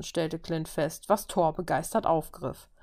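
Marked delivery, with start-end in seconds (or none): none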